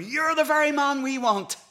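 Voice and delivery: high, loud eager exclamation; emphatic delight. Retold or imitated. Preacher to a self-declared pagan on his doorstep, emphatic delight. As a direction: burst out high and loud, holding it.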